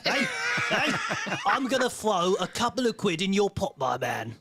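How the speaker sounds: matey mock Cornish-Cockney voice; chummy Cornish Cockney, greeting an old mate; Ray. Co-host imitating a listener pledging money to the host, Cornish Cockney. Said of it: "That's how Ray speaks".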